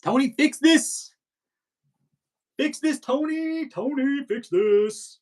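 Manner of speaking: high, slow call to Tony; insistent high call for a fix. Real, not acted. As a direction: call out across the room for help. Calling out to fix feedback.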